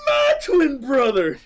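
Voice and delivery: high, loud wail broken by laughter; laughing mock wail. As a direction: wail it while cracking up. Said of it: "And he's".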